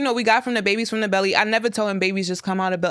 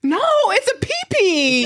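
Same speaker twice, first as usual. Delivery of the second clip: higher, slow, laughing pee-pee; prim mock correction; mother insisting on baby word with a laugh; 10 semitones higher, slower than usual.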